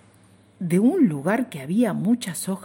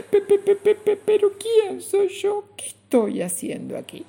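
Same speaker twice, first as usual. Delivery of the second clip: much higher, stuttering voice; stammering; 13 semitones higher than usual. Acted. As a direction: stammer in alarm. Bichito stammers to grasshopper.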